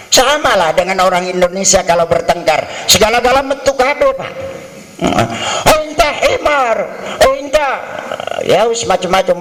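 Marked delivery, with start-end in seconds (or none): 5.0-7.8 higher, heated imitation of arguing Arabs; pitched-up mock quarrel in Arabic. Acted quarrel between Arabs.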